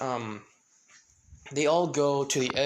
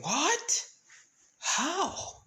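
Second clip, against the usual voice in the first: shocked squeak; 13 semitones higher than usual. Acted. Paimon shocked at quirkless news.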